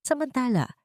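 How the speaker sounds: plain reading voice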